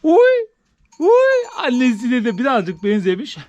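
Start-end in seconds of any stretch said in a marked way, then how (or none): 0.0-0.5 delighted squeal. Real, not acted. Delighted cry at the kid.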